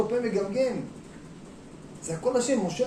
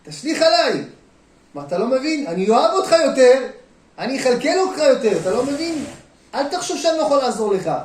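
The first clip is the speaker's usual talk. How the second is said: God calling out, higher and much louder; 5 semitones higher, 9 dB louder than usual. Acted. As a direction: boom it out as God pleading to be trusted. Voicing God's plea to the listener. (קול אלוהים קורא בקול רם)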